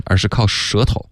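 ordinary delivery

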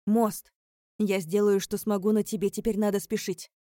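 loud, pressing reminder; urgent hurrying. Acted. Hurrying the heroine before bridge closes.

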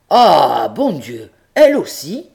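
high astonished cry; stunned cry. Acted. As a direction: shoot up in dismay at 'elle aussi'. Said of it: "cria monsieur Seguin stupéfait". (cri stupéfait, très aigu)

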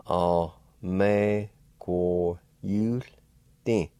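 All, me, go, yul, din, each syllable apart, yul said clearly; slow teaching syllables ending in yul, din. Shown as a demonstration. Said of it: "like this".

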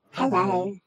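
put-on high little girl voice; pitch-shifted voice. Shown as a demonstration. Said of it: "this one is a pitch shifter".

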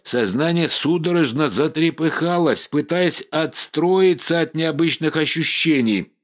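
ordinary delivery

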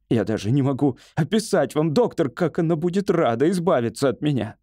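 high, aggrieved complaint; ranting hypochondriac. Acted. Patient tells doctor wife wants him gone, aggrieved.

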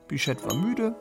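ordinary talk, nothing marked

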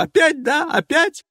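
high, loud rising indignation; outraged cry. Acted. Passenger outraged at the men laughing again, high and loud. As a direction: demand it in outrage.

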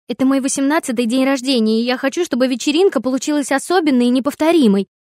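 put-on loud indignant teen girl; snorting back. A narrator's reading. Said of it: "фыркнула ему в ответ дочь". (возмущённо фыркая)

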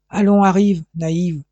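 impatient father ordering his daughter along; angry order. A narrator's reading. Enraged father drags his daughter away. (ordre sec et furieux)

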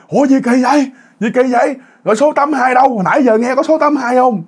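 high-pitched mock crowd in disbelief; retold outcry. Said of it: "nguyên một đám bắt đầu la làng".

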